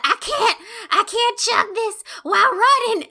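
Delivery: high whiny complaint; whiny gasping. Retold or imitated. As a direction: complain as if out of breath. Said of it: "I'm just like".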